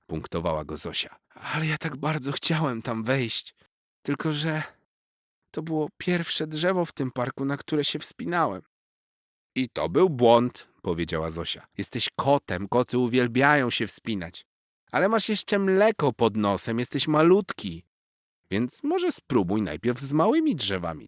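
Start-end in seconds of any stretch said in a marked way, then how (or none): 1.3-8.6 humbled cat. A narrator's reading. Narrator voices cat Garfik. 9.5-21.1 high, loud wise little squirrel warning; a cheeky squirrel teasing a show-off kitten; squirrel Zosia. A narrator's reading. Squirrel warning the boastful cat, high and loud.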